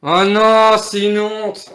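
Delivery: loud cry of dismay; dismayed outcry. Real, not acted. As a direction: shout out real disappointment. Losing position, dismay.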